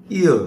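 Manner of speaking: "ear" nói rõ i rồi ơ, nuốt nhanh vào nhau; "ear" đọc i sang ơ liền mạch, nhanh; gliding ear diphthong. Shown as a demonstration. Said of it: "đọc i rõ và ơ rõ nhưng mà lại nuốt nhanh vào nhau". (đọc "ear" như nguyên âm đôi, lướt nhanh từ i sang ơ)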